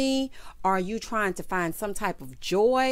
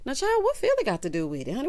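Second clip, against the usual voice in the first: sassy comeback; 8 semitones higher than usual. Retold or imitated. Sassy retort voiced in recap.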